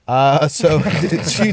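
ordinary delivery

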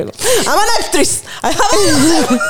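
mock diva. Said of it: "Esa es mi frase".

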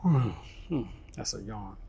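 usual speaking voice

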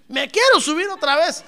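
excited retold thrill; eager wish. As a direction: burst out wanting another ride.

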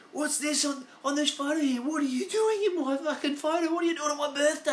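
high, loud mock-accusing disbelief; incredulous kid. Teen confronting best mate over photo, high incredulous demand. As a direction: confront your mate about the old photo. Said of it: "going down to his house and going".

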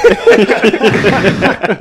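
big high laugh bursting out; real, loud laughing fit; loud burst of laughter. Real, not acted. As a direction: crack up loudly at the joke. Reacts to Grinch joke.

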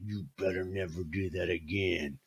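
low, soft menacing warning; hushed tough-guy threat; Dirty Harry. Retold or imitated. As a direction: imitate a steely movie cop warning a child. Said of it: "turns into Clint Eastwood, Dir-, Dirty Harry".

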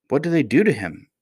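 lightly acted frightened child; trembling voice. A narrator's reading. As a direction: ask with a touch of dread. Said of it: "with a trembling voice".

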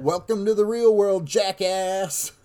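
high loud sneer; mock him as you trash the cake; scornful shout. Girl throws the cake.

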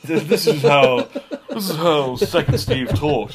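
slow words broken by laughs; laughing. Real, not acted. Laughing while explaining the voice.

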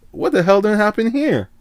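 very high-pitched mock disbelief; hammed-up bewilderment. Teller reacting to the cousins' mystery feud, comically bewildered. His baffled reaction to family spat.

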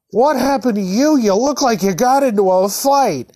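high-pitched acted alarm; shocked mom. Mom seeing her bloodied son, shocked.

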